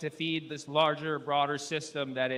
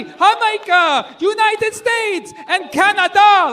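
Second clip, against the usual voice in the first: high, loud list of countries, slowing at the end; mock stage roll call; shouted roll call; 13 semitones higher, 12 dB louder, slower than usual. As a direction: hype the crowd, one country at a time. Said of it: "He's like".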